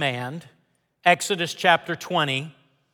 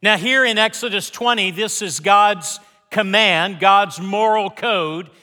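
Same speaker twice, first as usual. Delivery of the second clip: raised, rhythmic sermon delivery; preaching over music; 5 semitones higher, 6 dB louder than usual. Acted.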